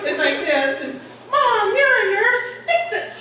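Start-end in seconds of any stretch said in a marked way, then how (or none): 1.3-3.1 put-on insistent child. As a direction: imitate a kid pleading with Mom. Child demanding help for cat.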